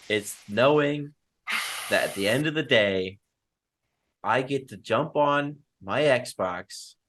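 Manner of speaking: slow, halting confession with long pauses; drawn-out, hammed-up sincerity; mock heartfelt speech. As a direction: lay on the guilt slowly, phrase by phrase. Mock heartfelt speech guilting a friend.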